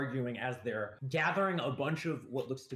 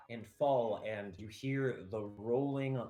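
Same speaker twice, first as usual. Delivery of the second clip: normal talking voice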